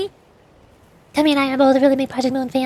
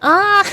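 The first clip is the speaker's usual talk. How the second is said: startled yelp; 6 semitones higher, 5 dB louder than usual. Real, not acted. Startled in game.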